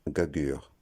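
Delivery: gagueur with a hard second G; wrong pronunciation. Shown as a demonstration. Teacher showing another mistake, gagueur with hard G. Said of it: "d'autres gagueur".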